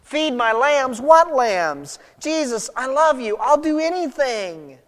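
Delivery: high, urgent, bewildered protest; desperate pleading. Acted. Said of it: "I think Peter had to be thinking".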